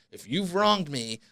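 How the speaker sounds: ordinary talk, nothing marked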